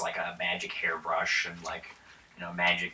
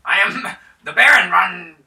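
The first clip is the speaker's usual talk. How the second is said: pompous noble voice, raised high and loud; a grand baron introducing himself; hammed-up aristocrat with a laugh; 11 semitones higher, 14 dB louder than usual. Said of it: "so he's like".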